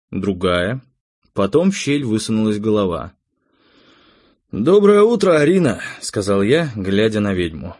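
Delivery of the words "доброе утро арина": high, loud morning greeting; raised voice hailing someone above; calling a greeting. Acted. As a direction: sing out a hearty good morning. Greeting witch he just woke.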